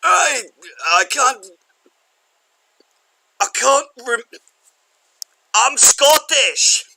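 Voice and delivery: very high, halting mock-Scottish accent; a floundering, hammed-up Scottish caller. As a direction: struggle along in a bad Scottish falsetto. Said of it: "lost the accent I was trying to do".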